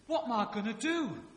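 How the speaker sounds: high, loud London soap wail; hammed-up London despair; London accent. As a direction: cry it out in EastEnders London. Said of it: "I'll do the accent".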